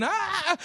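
high, thin mock wail; imitated baby scream. Preacher imitating the injured woman's baby, mock scream. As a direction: mimic a screaming baby without volume. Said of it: "her baby's screaming".

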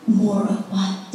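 low, soft and gentle; still small voice. Retold or imitated. God answering her prayer, low and softly. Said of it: "a still small voice of God saying".

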